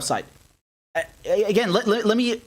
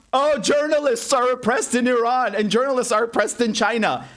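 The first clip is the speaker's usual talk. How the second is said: mocking imitation; 6 semitones higher than usual. Mocking US rhetoric.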